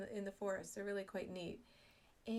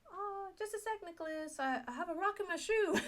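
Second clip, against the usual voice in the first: high, bright fake excuse to stall for breath; a hammed-up stalling excuse; mock excuse; 9 semitones higher, 6 dB louder, faster than usual. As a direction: chirp a pretend excuse to the son. Pretending to stop for a rock.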